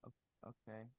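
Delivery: almost inaudible, very faint; barely audible. Real, not acted. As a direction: say it so softly it barely registers. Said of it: "Hunter, your voice is very low".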